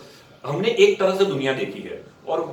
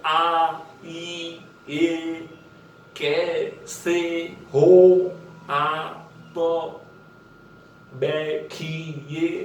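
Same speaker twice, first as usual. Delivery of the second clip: every sound of आइए, कैसे हो आप, बैठिए pulled long and slow; stretched out; much slower than usual. Shown as a demonstration. Acting coach shows students the stretched dialogue drill, very slow. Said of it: "आप पूरा stretch करके बोलोगे".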